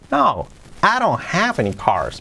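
"no, I don't have any cars" slow, with a high swoop on "have"; expressive intonation. Shown as a demonstration. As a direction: swing the tune up on "have", then fall through "any cars". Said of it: "Y con la entonación". ("no, I don't have any cars" con entonación marcada)

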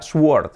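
"sword" with the w sounded, like "swuord"; mispronounced sword. Shown as a demonstration. Teacher showing learners his old mistake, mispronounced sword. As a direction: say "sword" wrongly with an audible w. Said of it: "yo siempre decía".

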